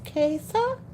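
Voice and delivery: QUESA as two syllables, KAY-suh; syllable demo. Shown as a demonstration. Teaching the audience the syllables, said correctly. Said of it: "Two syllables".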